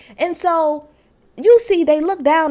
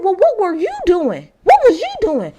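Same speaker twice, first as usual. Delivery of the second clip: higher, louder, indignant demand; Sir Eric demanding; 7 semitones higher, 5 dB louder than usual. Retold or imitated. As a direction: toss the question back at him, higher and louder. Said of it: "So Sir Eric said".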